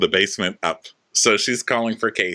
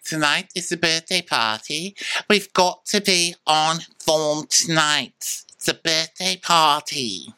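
high, slow put-on British accent; imitating British stew; 9 semitones higher than usual. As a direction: lightly do the British boss rallying her crew. Imitating British chief stew Faye.

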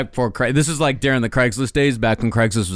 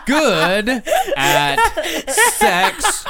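laughing; 9 semitones higher, much slower than usual. Real, not acted. Typing query laughing.

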